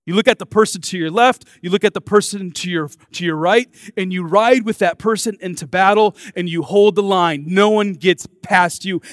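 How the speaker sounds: loud, stirring commander's address; Maximus rallying his soldiers. Retold or imitated. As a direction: rouse the troops before the charge. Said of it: "tells his soldiers before going into battle".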